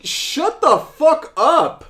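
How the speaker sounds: high, loud, drawn-out outburst; exasperated yell. Retold or imitated. Viewer yelling back at the daughter on screen, exasperated. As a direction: yell back in utter irritation. His exasperated reply to her.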